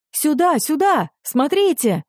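high, urgent call across the beach; excited high shout of discovery. Acted. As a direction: summon everyone over, eager. Villager calling others to beach.

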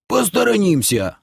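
высокий протяжный возглас старика; согласись бодро, голос вверх; старик соглашается. A narrator's reading. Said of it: "согласился Лохар". (бодрое согласие высоким стариковским голосом)

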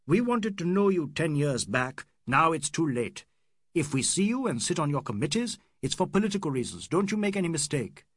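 cried out. Acted. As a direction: fling it at the English. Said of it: "He cried".

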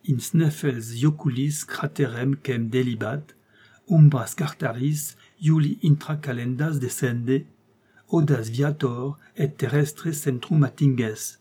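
slow, deliberate Latin incantation; solemn Latin reading. A narrator's reading. Uncle reading the coded Latin, slow and moved. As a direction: read the ancient text with awe. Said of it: "la voix émue".

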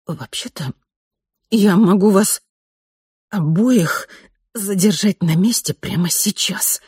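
strained, groaning through pain. Acted. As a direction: squeeze it out with a groan. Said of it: "прокряхтела".

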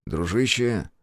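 plain reading voice

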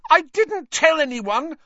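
very high, loud shriek. A narrator's reading. Hermione feeling betrayed by Lupin, shrieking. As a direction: shriek it in outrage. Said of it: "Hermione shrieked".

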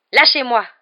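high, loud sharp command; disgusted outburst. A narrator's reading. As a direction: throw off his grip with disgust. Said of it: "lança Harry". (ordre lancé avec dégoût)

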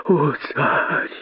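high, soft, whimpering entreaty; pleading. A narrator's reading. Kosoy begging the python king, soft high plea. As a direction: implore in a small high voice. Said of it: "взмолился Косой".

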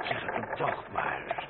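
slow amused insistence. Acted. Amused wounded marquis insisting.

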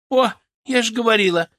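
выше и громче, радостно восклицая; выкрикни с торжеством; восклицание. A narrator's reading. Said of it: "воскликнула Лидочка". (звонкое восклицание)

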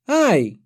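короткий высокий вскрик девочки; ойкни тоненько, как от укола; детское «ай». A narrator's reading. В девочку попадает стрела. (детский вскрик от боли)

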